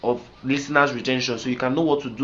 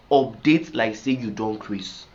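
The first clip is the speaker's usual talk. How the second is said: ordinary talk, nothing marked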